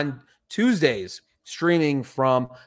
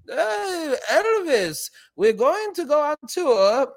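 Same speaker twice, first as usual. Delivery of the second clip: much higher, mock German accent, slow at the end; hammed-up German manager impression, pitched way up; weird German accent; 14 semitones higher, slower than usual. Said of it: "weird German accent".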